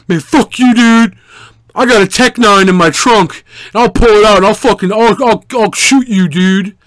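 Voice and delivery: high, loud hammed-up tough-guy threat; mock drunk threat. Drunk guy bluffing about a gun in his trunk, mock loud threat. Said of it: "trying to psych me out".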